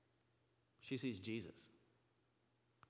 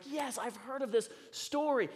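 high, loud eager yes; excited recognition; 11 semitones higher, 5 dB louder than usual. Retold or imitated. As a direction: jump in with delighted recognition. Acts out excited response.

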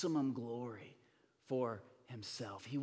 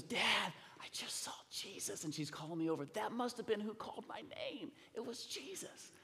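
put-on higher-pitched, wonderstruck kid telling dad; excited child; 7 semitones higher than usual. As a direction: imitate an excited little girl's report. Excited child reports vision to dad.

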